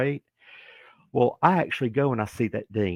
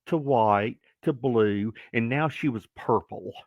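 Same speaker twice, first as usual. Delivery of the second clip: ordinary delivery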